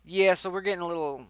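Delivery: ordinary talk, nothing marked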